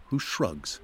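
ordinary talk, nothing marked

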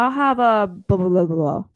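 mock mumbled customer order; imitated mumble. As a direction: trail off into unintelligible mumbled blahs. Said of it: "he keeps mumbling the important part".

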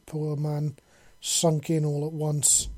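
usual speaking voice

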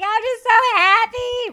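high, loud, drawn-out mock wail; mock happy crying. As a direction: wail it slowly, imitating tears of joy. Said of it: "And so I start crying".

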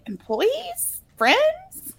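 high rising unsure questions; fishing for the right word; squeaky tentative guessing; unsure questioning. Real, not acted. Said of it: "searching for the word".

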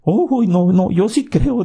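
high, quick scared denial; nervous hasty protest. A narrator's reading. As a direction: blurt it out nervously. Katy hastily reassures angry god.

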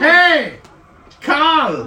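high loud call across a room. Acted. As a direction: call a friend across the hall. Calling across the canteen.

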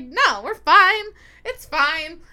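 mock dismissive reassurance. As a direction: imitate a friend waving away danger. Friend brushes off danger in cave.

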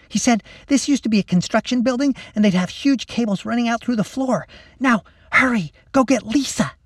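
put-on high-pitched, eager girl's chatter; excited young plotter in a hurry; bossy schoolgirl. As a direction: explain quickly, then order your friend along. Lacey explains, then hurries him.

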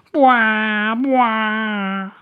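loud, high boo-hoo wail; cartoon sobbing. A narrator's reading. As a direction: bawl like a big child. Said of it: "seguía llorando".

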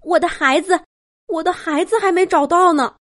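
high, worried mother's voice; anxious rabbit mother. A narrator's reading. Rescued rabbit mother missing her child, anxious and high. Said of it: "兔妈妈着急地说".

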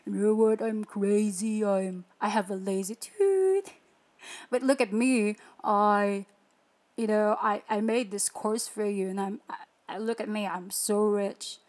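a hint of a show-off guru bragging to you; boastful guru. Mocking a rich online guru selling his course, lightly boastful. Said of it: "hunky punky gurus ... proudly telling you".

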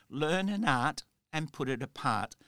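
usual speaking voice

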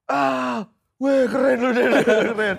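high, loud laughing cheer; excited praise. Retold or imitated. Friends praising the driver after the stop, loud laughing cheer. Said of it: "begitu berhenti".

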